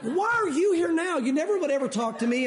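hammed-up high-pitched grievance; mock exasperated complaint. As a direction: whine at an unwelcome interrupter, half laughing. Acts out reaction to drunk interrupting.

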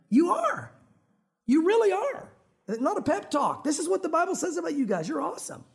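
high, insistent affirmation; emphatic encouragement. Real, not acted. Preacher convinces the congregation, emphatic encouragement. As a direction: lift the pitch and insist on it.

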